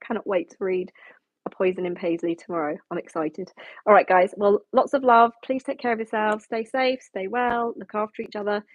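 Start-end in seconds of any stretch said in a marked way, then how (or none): none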